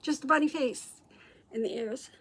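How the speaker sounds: normal talking voice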